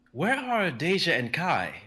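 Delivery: slow, high, demanding query; Akalos asking. Acted. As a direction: press them on where the two are. Narrator voices Akalos demanding.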